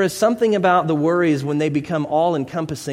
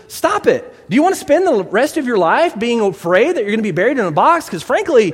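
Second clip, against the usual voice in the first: high, loud insistent rebuke; exasperated scolding; 7 semitones higher, 5 dB louder than usual. Acted. Therapist scolds client about fear of burial, loud and exasperated. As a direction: hammer the advice home, raised voice.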